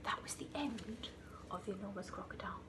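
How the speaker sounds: ordinary delivery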